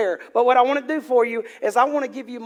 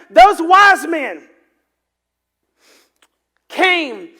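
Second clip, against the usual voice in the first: slow, emphatic; 4 semitones higher, 7 dB louder, much slower than usual. Acted. Preacher repeats the key phrase.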